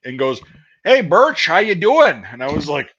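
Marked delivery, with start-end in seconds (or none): 0.8-2.2 loud, high-pitched mock greeting; imitated hearty hail. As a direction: call out like someone rousing a dozing soldier. Man kicks feet, hails him.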